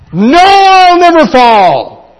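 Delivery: high, loud, slow boastful protest; cocky new believer. Acted. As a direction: shout the brash denial like a naive convert. Overconfident new believer voiced.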